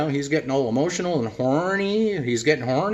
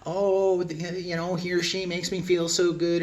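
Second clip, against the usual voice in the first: mock gushing; 4 semitones higher than usual. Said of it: "aren't supposed to be all emotional".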